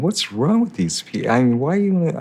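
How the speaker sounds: high-pitched mock bafflement; exasperated inner voice, pitched well up; retold exasperation. Young artist puzzling over gallery people's demands, exasperated.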